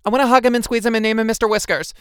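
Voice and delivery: loud, high, gushing outburst; bursting excitement. A narrator's reading. Lulu held back from the kitten, bursting with excitement.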